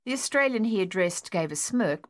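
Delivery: ordinary delivery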